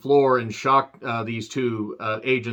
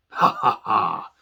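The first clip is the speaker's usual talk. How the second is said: mock laugh; 5 semitones lower, slower than usual.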